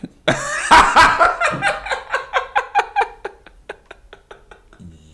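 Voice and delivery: long high laughing fit; loud cackling laugh. Real, not acted. Host cracking up at own dark joke, loud laughter. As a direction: howl with laughter.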